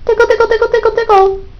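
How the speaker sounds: squeaky praising a dog. Acted. Owner coaxing bird in high voice.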